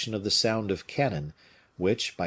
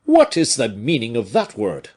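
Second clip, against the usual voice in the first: loud, affronted challenge; indignant demand; 3 semitones higher, 9 dB louder than usual. A narrator's reading. Porthos challenges the word grace, indignant and loud. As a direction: demand an explanation at full voice. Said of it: "replied Porthos with flashing eyes".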